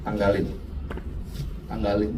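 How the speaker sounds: low, subdued command from God; Tuhan memerintah. Acted. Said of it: "Tuhan bilang". (perintah Tuhan, rendah dan agak lirih)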